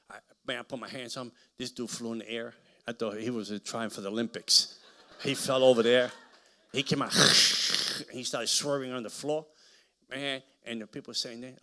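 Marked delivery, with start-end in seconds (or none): none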